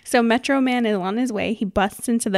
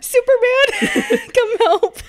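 mock cry for help; 6 dB louder, much slower than usual. Mock panicked crowd calling hero.

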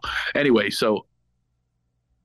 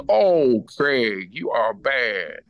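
Magic Johnson impression; 7 semitones higher than usual. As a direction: throw the co-host under the bus. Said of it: "he just goes".

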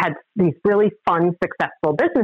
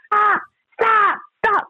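retold yell; slower than usual. Boyfriend waving them to stop.

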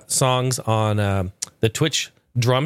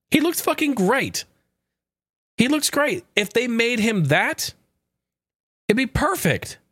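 1 excited praise; 9 semitones higher than usual. Real, not acted. Excited about the fan edit.

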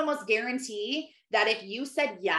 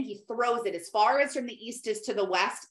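ordinary delivery

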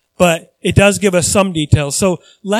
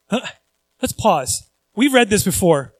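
usual speaking voice

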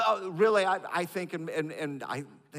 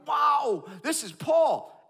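awed emphasis; 10 semitones higher, 8 dB louder, much slower than usual. Real, not acted. Awed emphasis on Paul's status.

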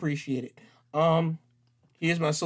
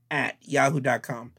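usual speaking voice

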